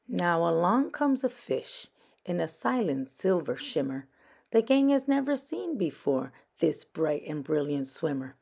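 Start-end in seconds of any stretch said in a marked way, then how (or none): none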